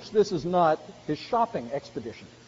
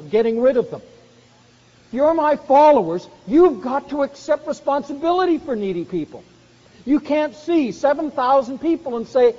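louder, higher, driving each line home; emphatic command; 6 semitones higher, 8 dB louder than usual. Acted. Preacher voicing the Lord commanding disciples, loud and emphatic. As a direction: press the charge on the listeners.

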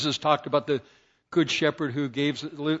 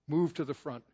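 ordinary delivery

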